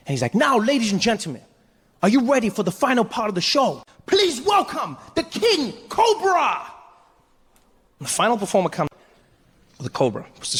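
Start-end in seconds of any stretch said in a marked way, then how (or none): 0.3-6.6 imitating a ringmaster's climactic introduction; excited announcer. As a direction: show host building to a high, stretched shout. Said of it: "Deng Basan came out one final time".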